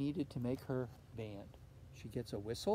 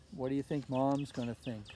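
usual speaking voice

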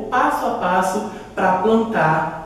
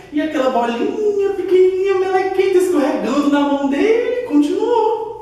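much higher, squeaky storytelling voice; tiny voice; 9 semitones higher than usual. A narrator's reading. Narrator describing the tiny slimy poop ball, high. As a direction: make it sound tiny and slimy.

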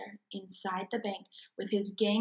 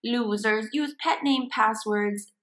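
usual speaking voice

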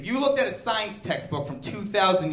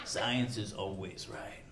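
smug atheist; 9 semitones lower, 6 dB quieter, slower than usual. Retold or imitated. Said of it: "where he goes".

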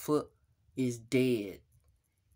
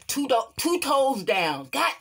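shrill outcry; 8 semitones higher, 7 dB louder than usual. Acted. Another toe lost.

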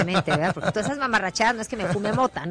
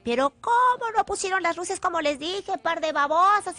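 higher, exasperated scolding of the crew; jarocho temper; 9 semitones higher than usual. Retold or imitated. Perfectionist singer scolding workers over the lights, higher and exasperated. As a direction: snap at them like a hot-tempered jarocha. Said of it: "me sale lo jarocho".

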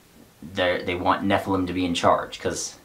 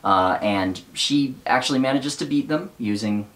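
usual speaking voice

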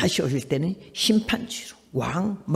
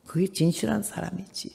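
ordinary talk, nothing marked

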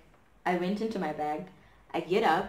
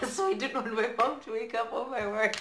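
laughing; 5 semitones higher than usual. Real, not acted. Laughing at own story.

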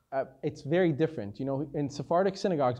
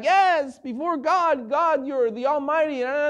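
much higher and louder, over-the-top reverent; sanctimonious gush, pitched up and loud; mock pious gushing; 10 semitones higher, 6 dB louder, slower than usual. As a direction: ham up a fake show of devotion to God. Mocking a falsely pious prayer.

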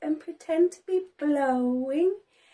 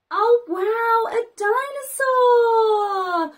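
excited; 5 semitones higher, 4 dB louder than usual. Acted. Excited story voice for toddler.

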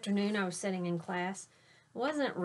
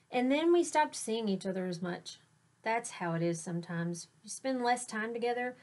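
expressive picture book read aloud; story narration; slower than usual. A narrator's reading. Teacher reading a picture book to her students, lively storytelling. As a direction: read it to your class like a storyteller.

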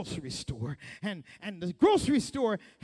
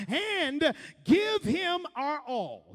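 preached shout; 7 semitones higher, slower than usual. Real, not acted. Preaching climax.

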